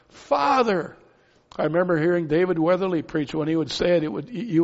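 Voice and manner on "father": high, fervent cry of Father. Retold or imitated. Preacher crying out to God, fervent high cry.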